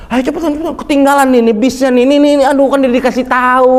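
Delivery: high, loud, flustered rushing; flustered hurrying. Retold or imitated. Panicky guide hurrying pilgrims toward the bus, high and loud.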